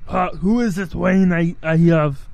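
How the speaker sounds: slow action-star impersonation; Arnold Schwarzenegger. Acted. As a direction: put on the famous Austrian actor's accent. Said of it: "I'm Arnold".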